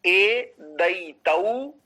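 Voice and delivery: ए दई तऊ drawn out very slowly; slow sutra demo. Shown as a demonstration. Teacher sounding out the sutra, slow. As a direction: stretch each syllable of ए दई तऊ. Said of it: "तो देखिए".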